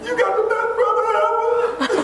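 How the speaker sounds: high, squeaky laughing voice; laughing retelling. Retold or imitated. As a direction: squeeze it out through laughter. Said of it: "she gets to the question".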